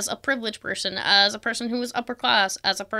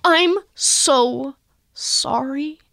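over-apologetic friend; 5 semitones higher, 6 dB louder, slower than usual. Retold or imitated. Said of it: "'Cause going".

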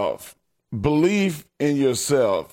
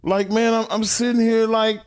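real welling emotion, pitch lifted; moved to near tears, voice riding higher; choked up; 4 semitones higher than usual. Real, not acted. Cohost moved by Tommy's story, choked up. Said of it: "my eyes welling up".

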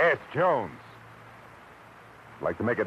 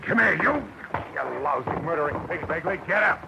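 furious shout; 10 semitones higher, 4 dB louder than usual. Acted. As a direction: roar it at him, high and loud. Marlowe grabs the murderer.

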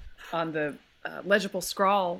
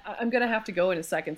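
ordinary delivery

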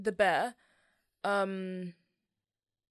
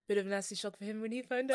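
attempted Irish accent; 4 dB louder, much faster than usual. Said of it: "let's do Irish accent".

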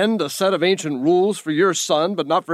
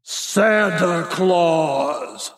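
slow and deliberate; 2 semitones higher, much slower than usual. Acted. Santa's slow answer.

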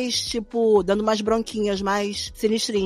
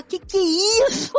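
surprised Mion quote; 9 semitones higher than usual. Retold or imitated. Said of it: "E fala".

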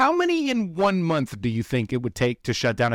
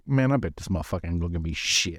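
low, fast mock muttering; imitated dismissive aside, pitched well down; imagined valet grumble; 8 semitones lower, faster than usual. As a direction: play the valet writing off the customer, low and quick. Voices the valet's low opinion.

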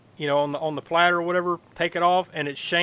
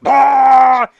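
loud, high, drawn-out fake retch; hammed-up sketch-comedy vomit scream; mock projectile-vomiting yell; 10 semitones higher, 14 dB louder than usual. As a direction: imitate puking in one long high blast. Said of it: "Saturday Night Live projectile vomiting".

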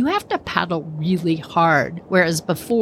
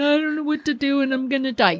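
mock crying; 6 semitones higher, faster than usual. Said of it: "she's crying".

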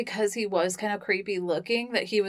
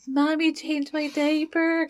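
put-on higher, slow baby talk; 8 semitones higher, slower than usual. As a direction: whine like a toddler.

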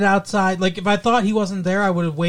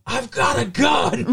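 retold scream; 6 semitones higher, slower than usual. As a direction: shout it like a panicked kid with a gun. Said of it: "he screams".

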